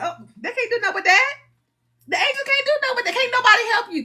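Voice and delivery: high, rapid-fire protest; mock exasperation. Speaker explains why angels can't help, high-pitched exasperation. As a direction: throw it up high and fast, exasperated.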